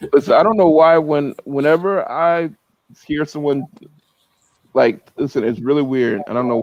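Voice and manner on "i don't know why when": ordinary delivery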